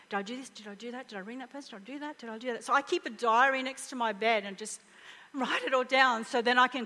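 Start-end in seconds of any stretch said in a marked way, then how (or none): 0.1-2.6 quick hushed self-questions, much softer; racing inner thoughts. Retold or imitated. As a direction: mutter the worries under your breath at dawn. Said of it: "My mind starts to think".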